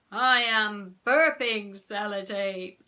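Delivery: slow, loud mock-trance chant; drawn-out joking recital; clowning fake trance. A narrator's reading. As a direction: drag the words out as a silly fake zombie.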